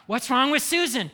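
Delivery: a hammed-up curious relative asking around; gossiping relative. Family members gossiping about someone outside, mock nosy. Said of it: "everybody's talking about it".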